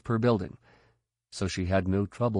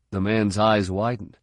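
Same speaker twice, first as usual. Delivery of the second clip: usual speaking voice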